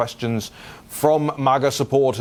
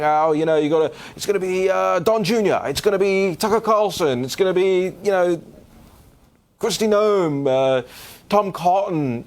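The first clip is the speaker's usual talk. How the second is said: higher, excited voice of people listing names; mock eager supporters; 6 semitones higher than usual. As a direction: play the chorus of eager fans, pitched higher. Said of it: "I live every day with people saying".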